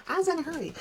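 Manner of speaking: high, squeaky mock-excuse voice; playful high-pitched self-mockery; silly high voice. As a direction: whine the excuse like a cartoon kid. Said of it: "repeated in silly voice".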